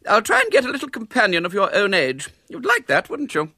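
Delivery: high, prim lady's voice; a polite spinster forcing kindness. A narrator's reading. Miss Milton to the disguised boy, high and prim.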